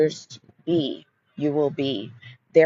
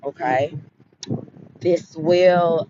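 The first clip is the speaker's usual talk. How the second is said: ordinary talk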